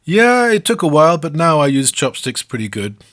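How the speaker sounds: proud, simple answer to the anchors; cheerful wrestler voice, easing down; Akebono's answer. Acted. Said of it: "Then he says".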